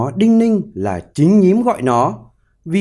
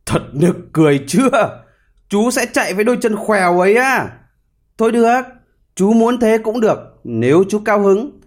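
higher-pitched storybook jeer; mocking; 6 semitones higher than usual. A narrator's reading. Hare scoffing at the hedgehog's crooked legs, mocking. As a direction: scoff lightly in a raised voice. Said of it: "Thỏ cười nhạo".

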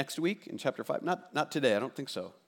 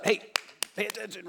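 wake-up call; 6 semitones higher, 4 dB quieter, faster than usual. Retold or imitated. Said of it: "James wants to wake us up".

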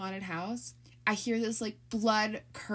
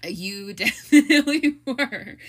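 slow, laughing agreement; 4 semitones higher, 13 dB louder than usual. Real, not acted. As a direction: laugh through the words.

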